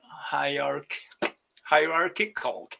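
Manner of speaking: ordinary delivery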